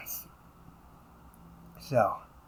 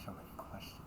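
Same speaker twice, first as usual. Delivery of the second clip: muttered aside; 5 dB louder than usual. Acted. Annoyed aside under breath.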